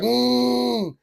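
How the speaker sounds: high strained grunt; mock grunt. Kid pushing against his hand, high mock grunt. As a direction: push out a high effortful hum.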